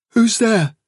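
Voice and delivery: loud, high challenge; tense shout to someone unseen; calling out. A narrator's reading. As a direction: call nervously into the dark. Hazel calls into the dark.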